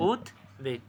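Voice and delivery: softly, "উদ্বেগ" as উদ্, pause, বেগ; split pronunciation. Shown as a demonstration. Teacher answering own question, "উদ্বেগ" soft and split. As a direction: answer with "উদ্বেগ" in two pieces. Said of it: "কী হবে".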